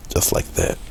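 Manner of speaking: breathy whisper to himself. Real, not acted. Painter whispering to himself over the stroke. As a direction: murmur it under the breath while brushing. Painter murmurs while brushing.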